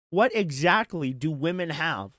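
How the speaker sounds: normal talking voice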